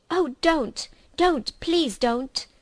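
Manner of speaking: Edmund shouting. Acted. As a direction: shout to stop the witch's wand. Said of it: "shouted Edmund".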